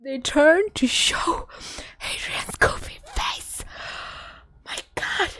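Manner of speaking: slow, voiceless fan gasping; overwhelmed whisper. Real, not acted. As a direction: whisper it as if the breath has gone. Fan overwhelmed, losing her voice.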